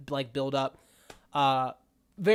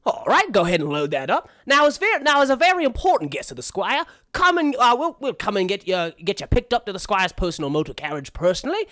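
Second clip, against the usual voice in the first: bustling, deferential servant patter; eager frog chauffeur; 2 semitones higher, 4 dB louder, faster than usual. Acted. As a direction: fuss over an important guest. Frog chauffeur offers guest a tour.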